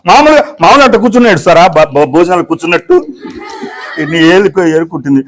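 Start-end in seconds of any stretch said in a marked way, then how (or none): none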